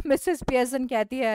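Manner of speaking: ordinary delivery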